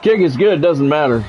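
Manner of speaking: usual speaking voice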